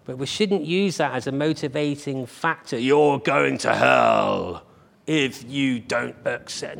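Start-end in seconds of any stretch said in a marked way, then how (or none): 2.8-6.8 slow, heavy, louder warning in a put-on preachy voice; slowed-down mock threat of hell; mock hellfire preacher. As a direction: ham up a condemning preacher to show what not to do. Mocking a fear-based preacher.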